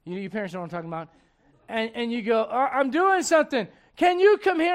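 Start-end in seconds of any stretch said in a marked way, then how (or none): 2.4-4.7 raised call from a busy parent; parent calling back. Retold or imitated. Parent answering child's call.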